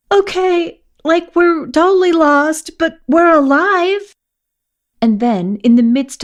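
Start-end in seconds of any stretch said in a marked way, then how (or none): 0.1-4.1 much higher, louder sudden gush; blurted out. Acted. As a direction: blurt it all out mid-meltdown. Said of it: "and blurt".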